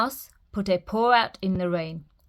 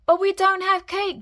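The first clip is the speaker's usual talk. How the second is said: grumbling Mouse; 11 semitones higher, 3 dB louder than usual. A narrator's reading. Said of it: "grumbled Mouse".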